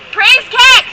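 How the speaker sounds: loud, high cry of praise; exclaiming. Acted. Telling the frog story to friends, a loud high exclamation. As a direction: shout it out like a rallying cry.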